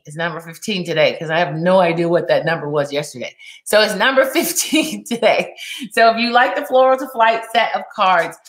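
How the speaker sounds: ordinary delivery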